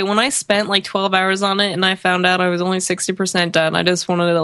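usual speaking voice

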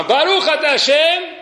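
high, slow, fervent blessing; acted intense prayer, drawn out; maximum devotion. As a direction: pray it out with full intent. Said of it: "Kavana máxima".

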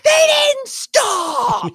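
retold scream. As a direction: yell it after the car that rolled through. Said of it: "I was screaming".

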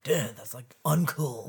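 lightly voiced surfer dude, stretched "uncool"; a hint of obnoxious surfer bro. Friends imitating the audiobook's villain, light surfer-dude drawl. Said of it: "He was, like, surfer dude".

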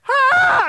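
sudden high, loud demonstrated wail; wailing cry. Shown as a demonstration. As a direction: let out a sharp mock wail of pain. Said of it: "describes wailing".

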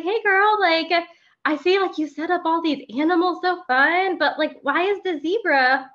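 high, bright-sung preschool teacher voice; cheery teacher voice. Retold or imitated. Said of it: "And I was just like".

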